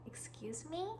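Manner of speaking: breathy whisper, "me?" rising; whispered disbelief. Acted. Whispering to viewers at the scammer's nerve. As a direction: mouth it in shocked disbelief. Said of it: "reacting in disbelief".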